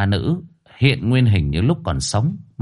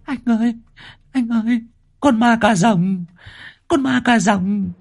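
cling and whimper about the vampire; frightened woman; 12 semitones higher than usual. A narrator's reading. Frightened woman hides behind Nam.

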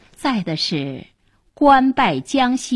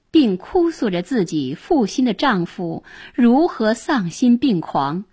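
usual speaking voice

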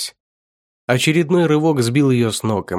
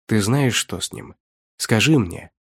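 plain reading voice

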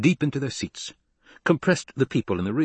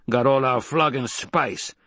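slow, drawn-out growl of complaint; 3 semitones higher, slower than usual. A narrator's reading. Crewman beside Paul growls about the lost spice, growling. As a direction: growl it slowly, understated. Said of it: "growled another".

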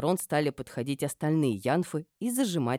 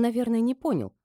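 ordinary delivery, nothing marked

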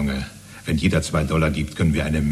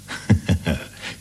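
breathless chuckle. Acted. Chuckles at child's cruelty.

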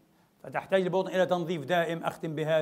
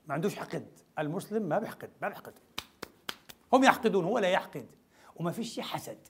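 ordinary talk

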